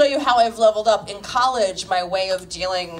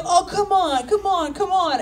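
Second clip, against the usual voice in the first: pleading guy; 8 semitones higher than usual. Retold or imitated. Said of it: "he kept being like".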